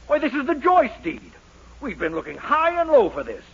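ordinary delivery

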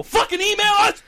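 loud frustrated yell. Real, not acted. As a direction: yell it at the listeners. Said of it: "Don't yell at them".

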